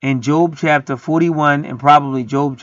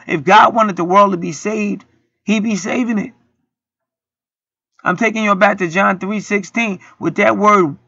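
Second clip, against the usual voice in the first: ordinary delivery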